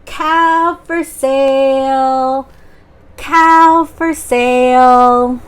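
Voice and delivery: slow, drawn-out loud market call; yelling out. Acted. Jack calling to the market crowd, slow loud call. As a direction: call it out long and loud, like hawking goods. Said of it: "he yelled out".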